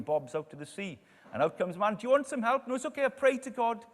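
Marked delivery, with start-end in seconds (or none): 1.9-2.7 high, loud mock hail; calling out. Submarine man offers help to stranded man, calling high and loud. 2.7-3.9 high, quick imitated refusal; calling back. Stranded man refuses the submarine, calling back high and quick.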